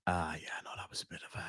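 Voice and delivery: whispered under the breath. Real, not acted. Muttering to himself after a bad joke, whispered. Whispered aside before retry.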